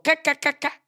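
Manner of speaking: high, staccato "ca-ca-ca" cracking noise; vocal sound effect of cracking shell; imitated eggshell cracking. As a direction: mimic tapping an egg open. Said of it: "le hizo".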